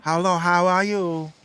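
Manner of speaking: a playful mock border accent; Mexican American accent. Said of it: "Like that".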